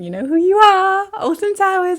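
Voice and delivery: loud, high call-out to an offender; mock call-out. Real, not acted. Calls out a theme park directly.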